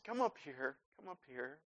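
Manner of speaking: very soft motherly coaxing; gently imitating mom; tender call. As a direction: coax a crying child close. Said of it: "She says".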